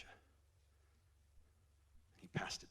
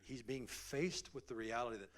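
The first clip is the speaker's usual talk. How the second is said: ordinary delivery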